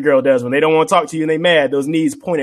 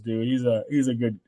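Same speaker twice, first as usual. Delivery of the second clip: ordinary talk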